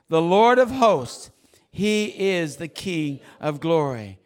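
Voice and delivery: slow, loud, weighty psalm reading; loud scripture proclamation. Real, not acted. Preacher reads psalm with congregation, slow loud proclamation. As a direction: declare it slowly at full voice.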